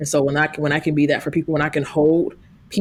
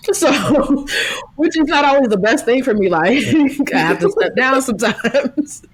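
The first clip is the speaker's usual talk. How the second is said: laughing through the words; 9 semitones higher than usual. Real, not acted. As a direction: giggle while admitting it. Laughing at herself after jail joke.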